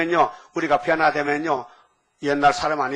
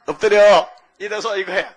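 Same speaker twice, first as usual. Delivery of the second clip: a loud barked down command; mock drill shout; 2 semitones higher, 3 dB louder than usual. Preacher mimics a drill instructor yelling at recruits. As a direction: snap the order at recruits.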